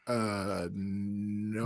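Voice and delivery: deadpan, low and flat. Retold or imitated. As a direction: say it flatly, unimpressed. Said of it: "No, flatly".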